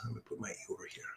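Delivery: whispered to herself; whispered aside. Real, not acted. Teacher whispering to herself while correcting the letter, a quiet whisper. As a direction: whisper it while fixing the board. Muttering while writing on board.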